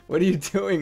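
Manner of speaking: usual speaking voice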